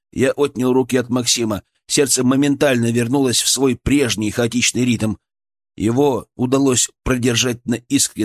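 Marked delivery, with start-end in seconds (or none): none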